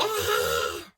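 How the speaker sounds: low loud squawk ah; parrot screech. Acted. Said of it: "Screeched a large shiny grey parrot".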